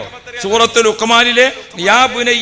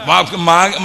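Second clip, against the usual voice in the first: usual speaking voice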